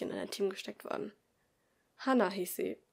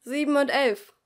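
loud, higher announcement; calling out; 5 semitones higher, 9 dB louder than usual. Acted. Teacher reads out the group numbers, loud. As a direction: read the pair out to the room.

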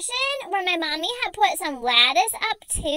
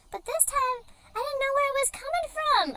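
ordinary talk

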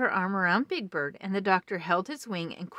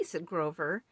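plain reading voice

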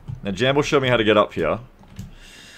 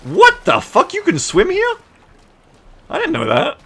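shocked surprise; 3 dB louder than usual. Real, not acted. Discovers swimming in game.

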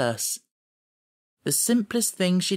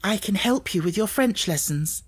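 put-on bright young Sarah; 7 semitones higher than usual. A narrator's reading. Sarah with a sudden gleam.

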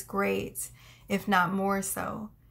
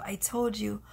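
ordinary talk

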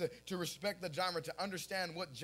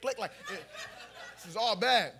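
ordinary talk

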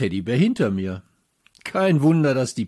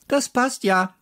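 called out; 12 semitones higher than usual. A narrator's reading. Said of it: "rief jemand".

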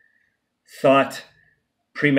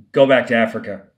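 louder, contemptuous retort; angry contempt; 6 dB louder than usual. Retold or imitated. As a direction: throw it like a threat, with contempt. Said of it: "in anger, contempt, and confrontation".

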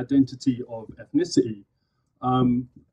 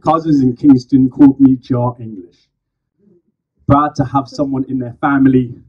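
spoken word poem; 6 dB louder than usual. Acted. Poet performing to a live crowd, loud slam declamation. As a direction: declaim each phrase to the crowd.

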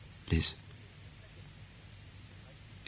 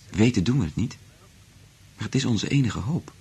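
higher, louder passionate plea; impassioned; 5 semitones higher, 4 dB louder than usual. Acted. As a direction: swell with desperate hope. Impassioned declaration of hope.

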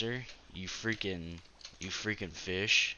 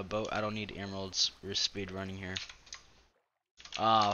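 usual speaking voice

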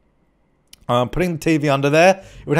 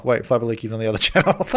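ordinary talk

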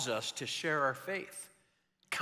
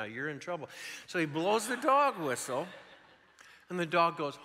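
normal talking voice

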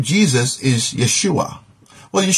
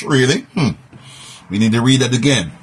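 ordinary talk, nothing marked

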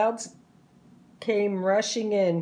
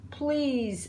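higher, soft plea; begging; 5 semitones higher, 4 dB quieter than usual. A narrator's reading. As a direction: plead gently for one more chance. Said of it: "begged Clara".

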